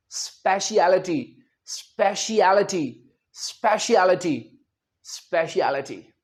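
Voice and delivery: स्पेशलिटी slow, "spe-shi-a-li-ti"; right speciality. Shown as a demonstration. Teacher giving speciality in five beats. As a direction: spell out each beat of स्पेशलिटी. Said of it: "मैं दो तीन बार बोलता हूं".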